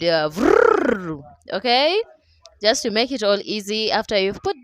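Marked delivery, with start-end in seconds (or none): 0.0-1.2 imitated blender whirr; blender noise. Shown as a demonstration. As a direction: buzz the lips like a blender. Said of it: "or you can use your blender".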